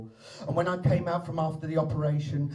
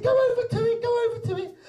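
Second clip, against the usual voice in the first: mock urging; 4 dB louder, faster than usual. Husband urging her on.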